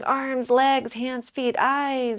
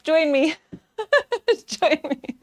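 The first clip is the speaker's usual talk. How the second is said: amused, higher and louder invitation; breaking into a laugh mid-invitation; 5 semitones higher, 3 dB louder than usual. Real, not acted. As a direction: laugh at your own slip as you call them over. Laughs at own mistake.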